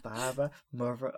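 quiet Irish father mother; Irish accent. Retold or imitated. Host retries the coroner's line in Irish. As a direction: try the Irish accent again softly. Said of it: "more Irish to my Irish accent".

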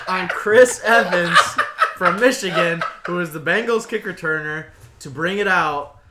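laughing. Real, not acted. Laughing retelling a big bet.

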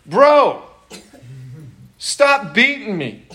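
a fed-up donkey pleading; hammed-up donkey complaint; exasperated donkey. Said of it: "The donkey goes".

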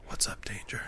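soft whisper under the breath; whispered aside. Pretend. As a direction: slip it out as a quiet joke. Whispered punchline in convenience store.

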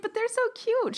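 cooing in a high voice. Retold or imitated. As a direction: gush high over the couple. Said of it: "she's like".

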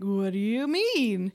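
slow, dopey drawn-out question; mock dumb-guy confusion; dim, baffled Jethro. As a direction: ask it like a slack-jawed simpleton. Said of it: "looking there with his mouth open".

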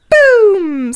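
loud high boom; explosion noise. Retold or imitated. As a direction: shout boom like a blast. Mimics explosion.

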